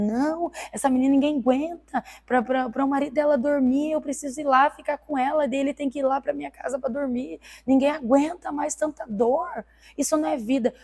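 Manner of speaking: a hint of a distraught mother; mother pleading. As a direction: voice the upset mother arguing. Mother arguing with doctor.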